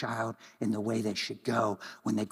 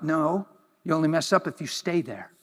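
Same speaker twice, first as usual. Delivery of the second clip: ordinary talk, nothing marked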